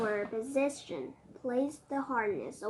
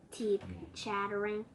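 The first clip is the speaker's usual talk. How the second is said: usual speaking voice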